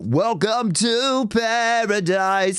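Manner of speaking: sung, weight on the start of "welcome"; high slow sung phrase, front-loaded; onset emphasis. Shown as a demonstration. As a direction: push at the beginning of "welcome to paradise". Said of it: "at the beginning".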